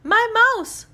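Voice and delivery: high, loud dismayed cry. A narrator's reading. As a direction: cry out for a lost pet. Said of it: "cried Sophie".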